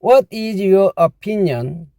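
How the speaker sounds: very slow, careful English; slow model phrase. Shown as a demonstration. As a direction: read it out slowly to copy. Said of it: "직역하면요".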